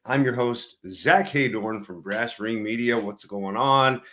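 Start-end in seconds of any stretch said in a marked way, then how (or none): none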